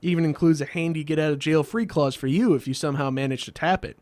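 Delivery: ordinary talk, nothing marked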